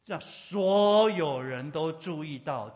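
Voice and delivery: slow, very loud emphasis; booming, drawn-out pulpit emphasis; loud proclamation. Real, not acted. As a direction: stretch it out and proclaim it to the whole congregation. Preacher proclaiming to congregation.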